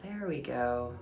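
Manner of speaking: soft, unhurried meditation guide voice; guided meditation. Acted. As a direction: ease them inward with calm breath cues.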